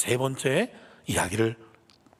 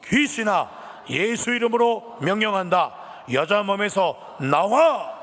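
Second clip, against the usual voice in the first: slow, higher call building to a shout; loud commanding cry; 5 semitones higher, 3 dB louder, slower than usual. Acted. Paul casting the spirit out of the girl, loud command. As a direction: command it out, stretching the last word. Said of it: "큰 소리로 외쳤습니다".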